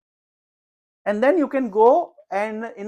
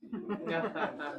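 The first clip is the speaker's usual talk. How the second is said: soft laugh; 3 semitones lower, 16 dB quieter than usual. Real, not acted. Amused at his own story.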